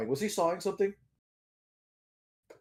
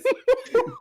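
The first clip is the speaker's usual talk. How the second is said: high-pitched laugh; 4 dB louder than usual. Real, not acted. High laugh between the words.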